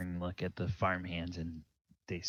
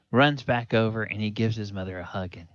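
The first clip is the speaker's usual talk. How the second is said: ordinary delivery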